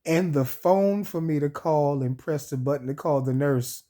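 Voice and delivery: ordinary talk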